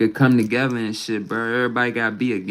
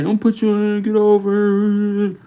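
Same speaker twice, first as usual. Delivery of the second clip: high mocking imitation of her scolding; mimicking her; 7 semitones higher than usual. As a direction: parrot her high and slow. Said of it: "She come right here".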